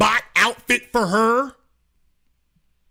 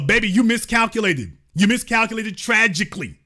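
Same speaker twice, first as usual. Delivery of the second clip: ordinary talk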